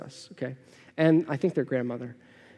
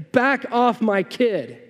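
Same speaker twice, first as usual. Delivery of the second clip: slow, higher, firm warning; protective parent; 4 semitones higher, much slower than usual. Pretend. Parent facing down a playground bully, slow firm warning. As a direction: plant yourself between child and bully. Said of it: "your mom or dad saying".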